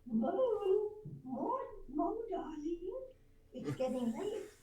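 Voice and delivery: high, coaxing wifely call; imitated wife gently hurrying him; nagging wife. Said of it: "you would hear one of them say".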